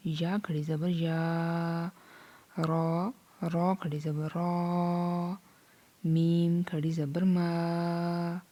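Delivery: slow, stretched lesson chant; Qaida recitation. Shown as a demonstration. Teacher drilling letters for students, slow chant. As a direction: lengthen each long vowel.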